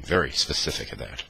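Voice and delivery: plain reading voice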